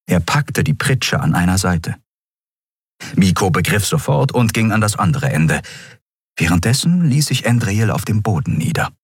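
usual speaking voice

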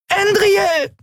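very high-pitched screech of a name; shriek. A narrator's reading. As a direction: shriek out in alarm for a friend. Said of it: "kreischte Nelen".

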